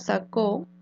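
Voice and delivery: "sacó" with the final CÓ stressed; stress on last syllable. Shown as a demonstration. Teacher giving an aguda example, "sacó" stressed on CÓ. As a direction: land on CÓ at the end of "sacó". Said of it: "donde es aguda".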